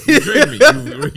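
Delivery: high, loud laughing complaint; laughing mock outrage. Telling a tiring person off, high and laughing.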